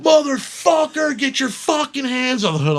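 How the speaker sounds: armed cop screaming at the suspect, furious; furious yell. Retold or imitated. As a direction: scream it with the gun out. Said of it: "he's just like".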